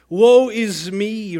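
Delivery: loud, slow, high cry; cried out. Shown as a demonstration. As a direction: wail it out with passion. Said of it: "Notice how he doesn't just say it, but he cries it".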